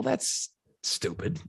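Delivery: ordinary talk, nothing marked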